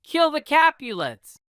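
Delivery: storybook crowd yell. Acted. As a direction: shout Kill the Capulets from the crowd. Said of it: "others yelled".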